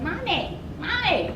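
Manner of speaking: a child's sing-song "Mommy" imitated; child calling mom. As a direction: call out like a small child wanting mom. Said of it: "somebody saying".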